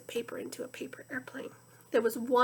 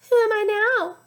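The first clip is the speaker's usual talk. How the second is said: put-on much higher, louder girl voice; sweet girl's question; high young girl voice; Leslie's voice; 10 semitones higher, 9 dB louder than usual. A narrator's reading. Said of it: "It was Leslie's voice".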